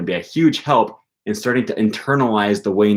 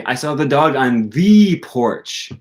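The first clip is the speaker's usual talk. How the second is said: the said strong, higher, slow; stress on the; 4 semitones higher, 3 dB louder, slower than usual. Shown as a demonstration. Teacher shows stress on the before porch. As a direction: hit the as thee. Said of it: "Not just any porch, the porch".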